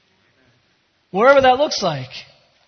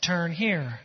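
soft, lower robotic direction; GPS voice; 4 semitones lower, 8 dB quieter than usual. Acted. Pastor voicing God's GPS, low and soft. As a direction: murmur it like a navigation voice. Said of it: "you hear that voice".

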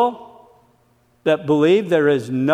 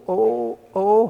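ordinary delivery

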